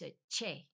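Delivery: che said as CHAY; Spanish letter name che. Shown as a demonstration. Naming a Spanish letter for learners, che as CHAY. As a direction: say che crisply as 'chay'. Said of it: "there's a C H".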